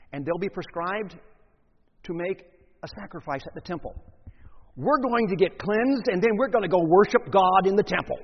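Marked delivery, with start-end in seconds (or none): 4.8-8.2 eager lepers. Acted. Healed lepers telling each other they're off to the temple, eager. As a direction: burst out in eager relief.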